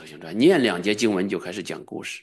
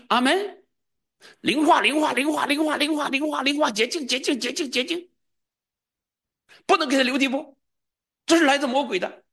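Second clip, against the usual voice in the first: fiery healing prayer. Shown as a demonstration. Preacher demonstrating a healing prayer over the sick man, fervent. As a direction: cast the sickness out at full force.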